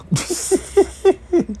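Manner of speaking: high-pitched cackle; high laugh. Real, not acted. Cracking up about the concrete wall, high cackle. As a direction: let a high cackle escape.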